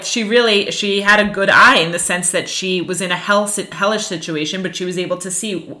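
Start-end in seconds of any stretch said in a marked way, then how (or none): none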